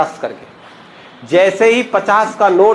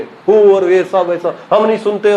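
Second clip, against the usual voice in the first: slow, stretched, hammed-up protest cry; mock ruckus shouting; slower than usual. Protester hollering at the officials, slow and hammed-up. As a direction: drag it out like an agitator refusing to listen. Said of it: "हल्ला मचा दिया उसने".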